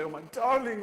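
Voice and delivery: high, loud gushing mother; mock doting mother. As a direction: gush it like a mother hugging her child. Imagined mother hugging son.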